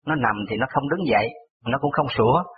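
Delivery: normal talking voice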